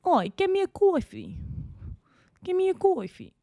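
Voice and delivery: very high, exaggerated New York accent. Shown as a demonstration. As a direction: demand it in a hammy New Yorker voice. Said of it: "trying to get the accent".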